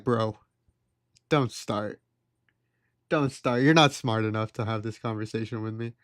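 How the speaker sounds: tag. Retold or imitated. Retold dismissal of unwelcome argument.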